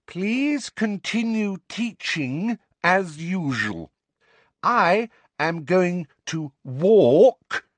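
high, heavily spaced-out condescension; one word at a time, as to someone slow; patronizing high official voice, drawn out; very loud and slow. A narrator's reading. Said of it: "said again very loudly and slowly".

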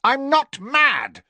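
high, loud and slow, each word hammered; shouted denial. A narrator's reading. Riddle shouting at Dumbledore that he is not mad, high and loud. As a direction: yell it like a boy who must be believed.

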